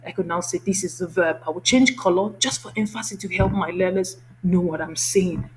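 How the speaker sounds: normal talking voice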